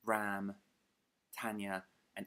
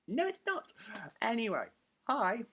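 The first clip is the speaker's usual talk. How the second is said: silly high voice; 14 semitones higher, 5 dB louder than usual. Pretend. Self-argument in silly high voice.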